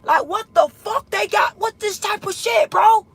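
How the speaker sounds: high, loud disbelieving shout; outraged outburst. Real, not acted. Telling viewers about the captors, shouting in outrage. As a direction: yell it up in pure disbelief.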